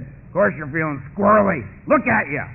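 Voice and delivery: loud, scornful reply; loud old-timer put-down; blunt old timer. Acted. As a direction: bark it at the newcomer. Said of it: "walked up to an old timer and said".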